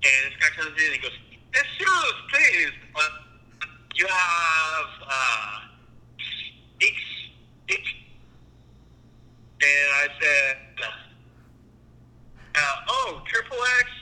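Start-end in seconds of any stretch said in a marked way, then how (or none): 1.6-7.8 put-on rough French-like accent. Customer asking a video clerk haltingly, uncertain French-like accent. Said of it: "I have to do the accent as I heard it". 12.9-13.9 higher-pitched "oh" of recognition; mild retold realization. Clerk guessing the customer's movie, mildly bright realization.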